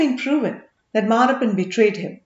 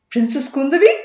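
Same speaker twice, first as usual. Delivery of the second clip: genuine surprise; 4 semitones higher, 7 dB louder than usual. Acted. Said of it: "asked the merchant with genuine surprise".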